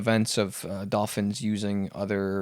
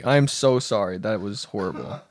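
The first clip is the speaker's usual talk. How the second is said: ordinary delivery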